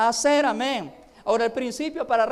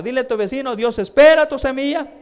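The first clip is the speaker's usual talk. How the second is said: loud high cry to the crowd; a fervent shout to the congregation; loud preaching call; 4 semitones higher, 9 dB louder than usual. Real, not acted. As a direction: call it out so the whole church repeats. Preacher calls phrase for congregation.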